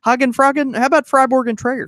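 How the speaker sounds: high loud mocking echo; playful high teasing. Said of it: "teasing the mispronunciation".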